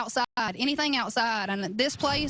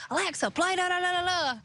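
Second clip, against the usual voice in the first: put-on Southern accent; 6 semitones higher, faster than usual. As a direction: rattle it off in thick Southern. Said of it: "we get in fights at the house because I'll be like".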